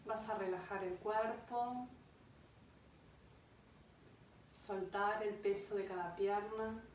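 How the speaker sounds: unhurried meditation voice with long pauses; guided meditation. Acted. Yoga guide leads a listener to an imagined beach, guided meditation. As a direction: guide them gently into rest, phrase by phrase.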